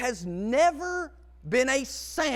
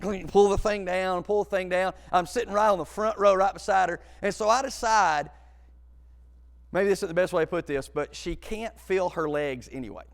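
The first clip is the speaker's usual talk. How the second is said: ordinary delivery, nothing marked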